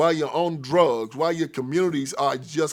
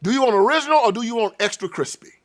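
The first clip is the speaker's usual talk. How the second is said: high, loud, sarcastic verdict; mocking judge; 9 semitones higher, 7 dB louder than usual. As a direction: taunt the sinner with a sneer. God mocking sinner sending to hell.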